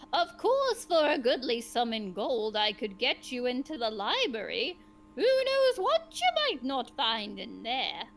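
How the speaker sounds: a greedy sage dangling a secret; sly old sage. Acted. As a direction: tempt them with the library, a crafty old sage. GM voicing sage offering a bribe.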